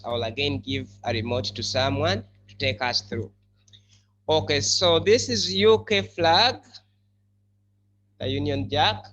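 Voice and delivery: ordinary talk, nothing marked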